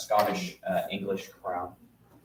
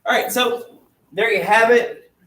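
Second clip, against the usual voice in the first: higher and louder, upbeat farewell to the class; bright excited sign-off; 7 semitones higher, 9 dB louder than usual. Real, not acted. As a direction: call out cheerfully to the students.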